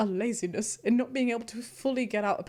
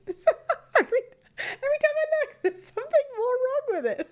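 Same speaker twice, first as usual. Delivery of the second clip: much higher, louder, breaking into laughter; laughing through words; 4 dB louder than usual. Real, not acted. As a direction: giggle helplessly through the words. Laughing at a silly drawing.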